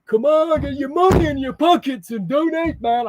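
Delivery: high, loud attempted Irish rant; badly faked Irish accent, demanding and worked up. Streamer impersonating a fundraiser demanding money, failed Irish accent. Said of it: "I can't do his Irish accent".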